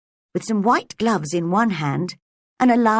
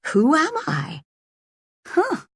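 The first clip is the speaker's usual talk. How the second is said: put-on higher, wondering little-girl voice; Alice puzzling who she is; puzzled girl; 8 semitones higher than usual. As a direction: ask it in baffled wonder. Alice puzzling over identity.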